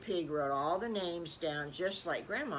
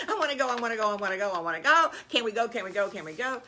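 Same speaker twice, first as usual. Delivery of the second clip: rapid-fire piglet pleading, loud; eager kid chanting a plea; excited begging; 2 semitones higher, 6 dB louder, much faster than usual. Acted. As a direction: beg over and over like an eager child. Piglets beg to go.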